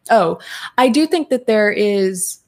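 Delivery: ordinary talk, nothing marked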